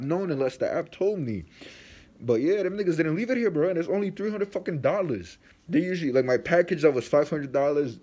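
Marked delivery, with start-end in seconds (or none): 2.2-8.0 heated rant. Real, not acted. Vlogger ranting at the delivery company, heated and raised. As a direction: vent hard about the missed delivery. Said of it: "that's my little rant".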